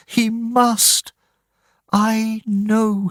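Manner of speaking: high, slow, faithful insistence; cling to hope; high mother's voice, slowing; insistent mother. Acted.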